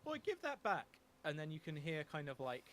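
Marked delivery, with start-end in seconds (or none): 0.0-0.9 high, louder cry after thieves; raised voice. Acted. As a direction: call out sharply, voice jumping up. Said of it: "someone kind of raise their voice".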